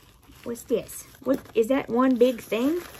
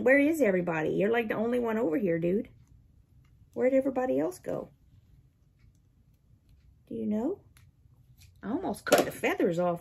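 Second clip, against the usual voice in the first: normal talking voice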